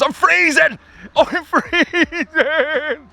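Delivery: high loud laughing squeal from cold; freezing squeal. Real, not acted. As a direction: yelp it out, shivering and laughing. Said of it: "I'm talking a little bit weird".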